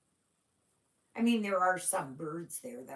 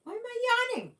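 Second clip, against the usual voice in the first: "Why am I yawning?" asked much higher and louder, in real surprise; surprised question; 5 dB louder, faster than usual. Real, not acted. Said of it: "I don't know why I'm yawning".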